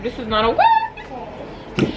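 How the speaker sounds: slow, high-ending protest; mock alarm. Watching a fight from the couch, high alarmed protest. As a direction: drag out the objection, sliding up high.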